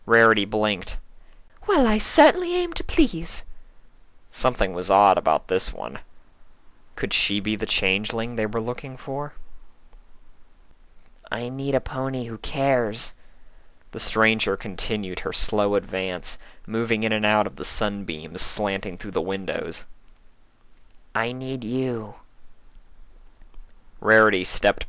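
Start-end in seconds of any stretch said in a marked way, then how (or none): 1.7-3.4 much higher, bright lady's voice; Rarity voice. Acted. 11.3-22.1 eerie changeling voice. Acted.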